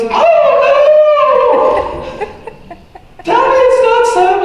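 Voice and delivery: hammed-up panicked mother crying out; imitating frantic mom. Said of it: "She goes".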